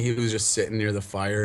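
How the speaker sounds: ordinary talk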